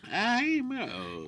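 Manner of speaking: put-on high, creaky old voice; very old man. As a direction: play a frail ancient man. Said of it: "Everybody who's old sounds like this".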